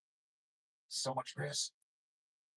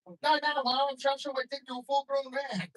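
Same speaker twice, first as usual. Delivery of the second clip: put-on high whiny kid pleading with his mom; whiny teen protest; 8 semitones higher than usual. Teen pleading with mom about the phone, high and whiny. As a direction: whine at Mom like a fourteen-year-old.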